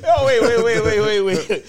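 loud, high rapid interrupting; excited. Real, not acted. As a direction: jump in urgently.

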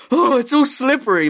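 high, loud gasp of pretend horror; hammed-up shock with a gasped start; mock horrified. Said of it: "look horrified and be like".

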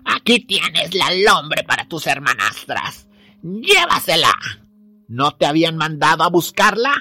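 put-on sly old witch, taunting. Baba Yaga hands over skull.

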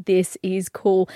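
slow hushed whisper. Retold or imitated. Quoting own thought.

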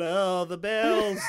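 loud, high, drawn-out Quasimodo wail; imitating hunchback Clouseau. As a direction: ham up a slow hunchback cry. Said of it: "he just starts going".

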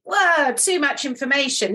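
a mock shocked cousin backing off from "Whoa"; recoiling whoa. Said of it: "like, literally".

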